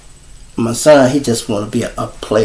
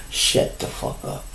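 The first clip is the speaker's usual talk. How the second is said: whispered shut up; 11 dB quieter than usual. Shown as a demonstration. Father telling a room of guests how to quiet down: whispered order. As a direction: Shut the fuck up hissed in a whisper. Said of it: "I'ma say that again".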